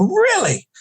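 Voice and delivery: big high incredulous rise; mock disbelief at the news; a hammed-up shocked squeak; surprised. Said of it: "I go".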